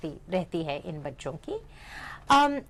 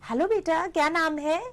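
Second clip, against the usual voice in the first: higher, sing-song question to a little one; adult greeting a child; 5 semitones higher than usual. Acted. Adult asks a child their name, higher child-directed voice. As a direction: ask it high, with a rise at the end. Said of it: "तो अगर आप पूछते हैं".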